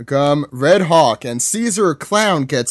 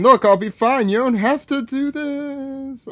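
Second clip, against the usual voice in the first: high, fading distant cry; falling girl; 11 semitones higher than usual. Retold or imitated. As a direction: call out while falling away. Voicing falling Tama.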